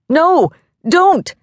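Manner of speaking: high, loud plea; desperate pleading. A narrator's reading. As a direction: beg him not to, voice leaping up. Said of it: "Mister Johnson pleaded".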